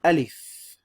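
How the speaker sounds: Alif said loud and clear, long open first a; Arabic letter name. Shown as a demonstration. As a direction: model the letter name Alif for students to repeat. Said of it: "One more time".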